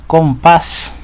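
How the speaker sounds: Compás with the weight on PÁS; comPÁS, set apart as an example; final-syllable stress. Shown as a demonstration. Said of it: "sílaba tónica está de último".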